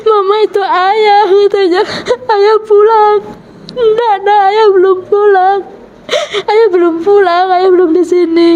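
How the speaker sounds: high, tearful voice breaking into sobs; choked-up crying, voice catching on the words; crying while retelling. Real, not acted. Mother retells child seeing late father.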